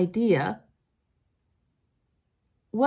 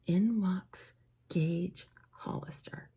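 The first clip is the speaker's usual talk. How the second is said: slow hushed whisper, teasing the reveal; dramatic whisper; 2 semitones lower, 6 dB quieter, slower than usual. Acted. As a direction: breathe the name like a secret. Dramatic entrance of the hero.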